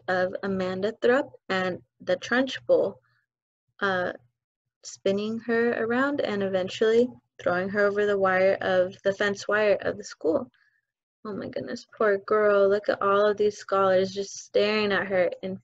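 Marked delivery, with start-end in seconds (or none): none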